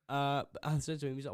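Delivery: ordinary delivery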